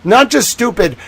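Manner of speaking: ordinary delivery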